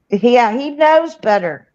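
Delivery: ordinary talk, nothing marked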